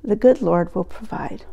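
an aging father gently easing his daughter's worry; weary tone. Retold or imitated. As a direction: let the fatigue sink into the words. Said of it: "in a weary tone".

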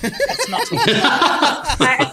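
high, slow, laughing brush-off; drawn-out giggling let's-not; laughing mock dismissal.

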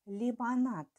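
dictating. Shown as a demonstration. Dictation, word said twice.

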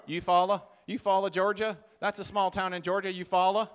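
put-on loud Southern US accent. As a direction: imitate a couple from Georgia and Alabama with Southern accents. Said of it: "they talk like this".

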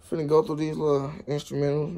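ordinary talk

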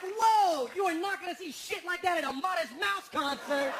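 high, loud amazed exclaim; mock-awed concertgoer; awed excitement. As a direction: gasp at the spectacle. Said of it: "And I was like".

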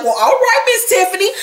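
high, teasing mock approval; sassy sing-song acknowledgement; playfully impressed. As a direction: pitch it up like an amused onlooker. Amused reaction to Tiffany's threat.

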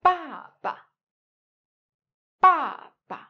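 "Ba ba" with a falling first syllable and a short, light, toneless second; father, "Ba ba" with light second syllable; neutral tone baba. Shown as a demonstration. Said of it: "read them together one more time".

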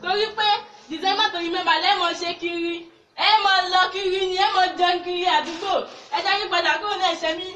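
fiery street preaching. Acted. Evangelist warning neighbours to repent, fiery street preaching.

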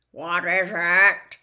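an ancient blind spider waking; giant spider. Acted. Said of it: "clicking his pincers rapidly".

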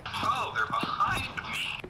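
slow, alarmed whisper. Real, not acted. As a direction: breathe it out, enemies close. Enemies close behind him.

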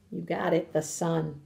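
plain reading voice

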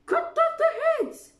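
very high, very loud order; furious queen. A narrator's reading. Queen orders the gardeners beheaded, furious. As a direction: scream the sentence.

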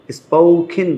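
স্পোকেন with aspirated k, slow; third pronunciation option. Shown as a demonstration. Teacher quizzing learners on which is right, aspirated k. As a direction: puff the k in স্পোকেন, like "spokhin". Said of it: "কোনটা হবে?".

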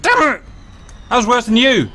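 high furious outburst; frustrated outburst. Real, not acted. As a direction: explode in frustration.

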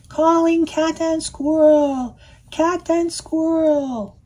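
high, sing-song megaphone call. Acted. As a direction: call them from afar. Megaphone dogs calling names.

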